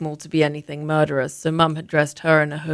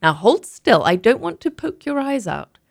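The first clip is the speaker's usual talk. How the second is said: mother's brisk voice; 4 semitones higher, 6 dB louder than usual. Acted. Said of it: "Mum said".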